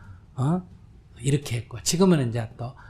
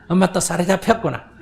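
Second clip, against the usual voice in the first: higher, louder mock scolding of mom; mocking child; 5 semitones higher, 7 dB louder than usual. Child scolds her mother in a mock-preachy voice. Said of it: "이렇게 얘기하면 안 된다".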